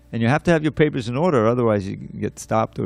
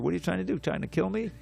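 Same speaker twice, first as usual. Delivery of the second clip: higher but softer retold panic; mock freak-out; 6 semitones higher, 8 dB quieter, faster than usual. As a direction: imitate a rattled soldier, voice raised in pitch only. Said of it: "he freaks out".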